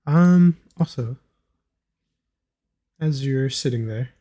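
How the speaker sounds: usual speaking voice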